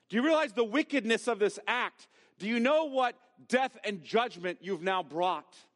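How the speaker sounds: stern, rising indignation; emphatic rebuke. Shown as a demonstration. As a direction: thunder the questions at her. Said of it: "More like".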